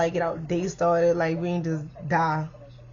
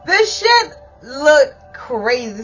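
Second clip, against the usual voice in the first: slow, loud, cracking with laughter; can barely get it out for laughing; laughing hard; 6 semitones higher, 7 dB louder, much slower than usual. Real, not acted. Recalling the crazy morning, real helpless laughter.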